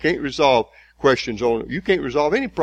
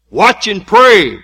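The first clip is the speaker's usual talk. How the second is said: loud, slow, hammering repetition; preacher drilling that the flesh is weak; preaching at full force; 8 semitones higher, 13 dB louder, much slower than usual. Acted. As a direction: pound the point home.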